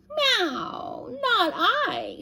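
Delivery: high cat voice with a meow. Acted. Voicing the cat.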